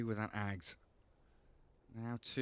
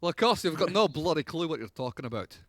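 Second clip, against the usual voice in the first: mock Scottish scolding; broad Scottish accent; 4 semitones higher, 6 dB louder than usual. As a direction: tell him off in broad Scots. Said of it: "I love Scottish accent".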